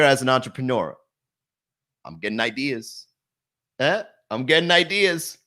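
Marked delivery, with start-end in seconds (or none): 2.0-4.0 De Niro impression. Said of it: "I'm like Robert De Niro". 4.3-5.4 De Niro impression. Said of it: "I'm like Robert De Niro".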